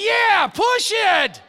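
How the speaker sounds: high, slow, loud shout; exasperated shout. Retold or imitated. Preacher yelling back at his passenger in the muddy car, loud and slow. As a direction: yell it slowly, drawn out and fed up.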